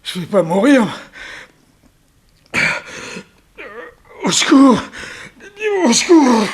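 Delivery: high, loud desperate cries for help; a poisoned man yelling for help in panic; panicked shouting. Acted. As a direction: scream for help in terror. Villain dying from snake bite.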